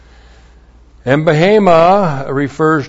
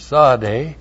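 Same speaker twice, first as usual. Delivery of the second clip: normal talking voice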